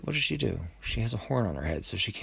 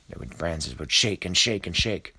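usual speaking voice

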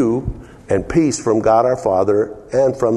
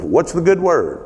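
cheery greeting; 8 semitones higher than usual. Retold or imitated. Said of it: "ever heard that".

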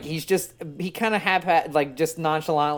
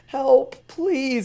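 high, pleading voice from the background; mock plea; 10 semitones higher, 4 dB louder than usual. Hostage voice on the prank call, high mock plea. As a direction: plead like a trapped victim.

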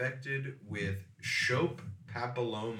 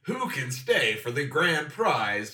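higher, louder game show announcer; booming prize announcer; 5 semitones higher, 5 dB louder than usual. Pretend. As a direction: sell the grand prize like TV. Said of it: "Taxidermist War".

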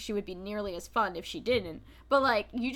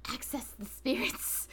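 whisper; 7 dB quieter than usual. Retold or imitated. Mystical presentation quote.

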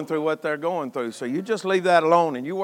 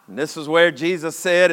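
usual speaking voice

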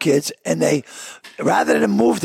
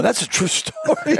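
laughing; 9 semitones higher than usual. Retold or imitated. Retold aside, laughing.

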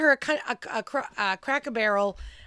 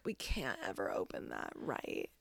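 much lower and much softer, half-whispered worry; a hushed, lower-voiced mom; imitating mom, hushed; 9 semitones lower, 10 dB quieter than usual. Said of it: "My mom's like".

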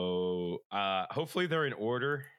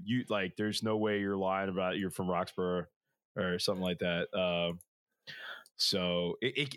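ordinary delivery

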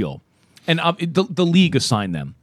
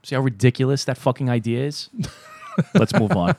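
ordinary talk, nothing marked

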